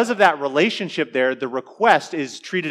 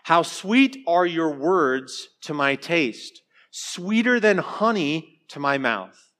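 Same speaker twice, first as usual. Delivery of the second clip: slow scripture reading; 2 semitones higher, slower than usual. Acted. Reading psalm verse slowly.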